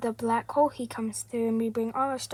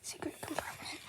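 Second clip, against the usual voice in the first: whisper; 3 semitones higher, 16 dB quieter than usual. Pretend. Shows a secret compartment.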